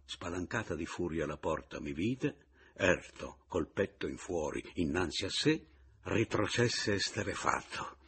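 ordinary delivery, nothing marked

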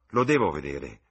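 insist, raising the voice; a forceful cry of need; urgent shout. A narrator's reading. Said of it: "gridò".

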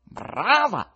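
high, loud cry of delight; exclaiming bravo. Acted. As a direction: cheer her on stage.

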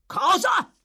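high, loud outburst of alarm; shocked yell. Acted. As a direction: yell it as if leaping from your chair. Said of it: "urlò l'ebreo balzando su dalla sedia".

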